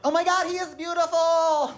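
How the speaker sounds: very high, put-on admiring squeal; over-the-top fake adoration; mock gushing. Mock gushing over an ugly baby.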